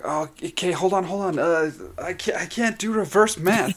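high, stammering mock fluster; flustered imitation. Imitating a player who rolled too many dice, flustered. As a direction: act out getting flustered, voice climbing. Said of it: "and he gets flustered".

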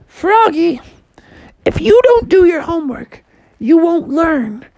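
high-pitched mom voice; high mock-motherly lecture; Froggy's nagging mom.

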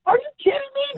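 high, squeaky disbelief; mock outraged courier; incredulous. Courier reacting to the dispatcher, high incredulous squeak. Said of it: "I'd be like".

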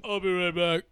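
lightly put-on fake voice; imitating a poor attempt at another voice. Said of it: "do a fake voice".

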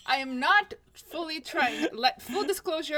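loud, high laughing and crying out; real laughter; laughing protest. Real, not acted. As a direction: protest while breaking up laughing. Laughing defense after being teased.

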